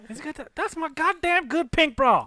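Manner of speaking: high indignant woman voice. Pretend. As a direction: whine it like a wronged girlfriend. Mock girlfriend voice.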